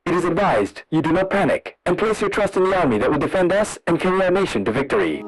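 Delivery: ordinary talk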